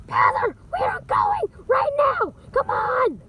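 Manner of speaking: high, loud urgent shout; yelling. Acted. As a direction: yell it as an order to leave now. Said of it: "Why am I yelling?".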